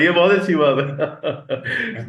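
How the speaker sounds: slow, laugh-broken praise; amused, chuckling agreement; laughing approval. Real, not acted. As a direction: say it through real laughter. Amused reply after shared joke.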